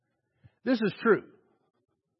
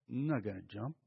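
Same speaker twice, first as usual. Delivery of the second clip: quiet refusal; 7 semitones lower, 12 dB quieter than usual. Acted. Man refusing to jump.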